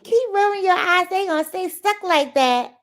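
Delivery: high, loud mock scolding. Repeating the eye-rolling saying for viewers, high mock scolding. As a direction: pitch it up like a nagging warning.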